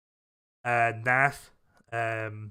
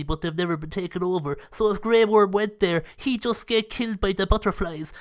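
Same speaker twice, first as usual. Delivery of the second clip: a higher, put-on know-it-all voice spilling book lore; nerdy voice; 3 semitones higher than usual. Shown as a demonstration. A fan explaining book lore to viewers in a higher nerdy voice. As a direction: play the eager book nerd who can't help correcting everyone. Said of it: "I'm gonna say it in the nerdy voice".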